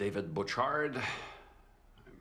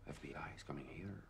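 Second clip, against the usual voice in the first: whisper; 4 semitones lower, 14 dB quieter than usual. Acted. Player whispering at the news.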